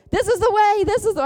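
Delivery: high, loud mock guiding call; super-clear voice. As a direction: call it out like an unmissable instruction. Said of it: "it doesn't always sound super clear".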